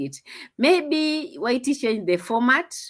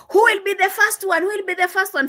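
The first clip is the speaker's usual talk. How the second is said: mimicking the hype; 11 semitones higher, 3 dB louder than usual.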